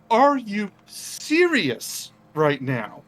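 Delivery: high, slow, disbelieving; incredulous Quinn. Acted. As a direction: stretch it out in utter disbelief. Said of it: "Quinn goes".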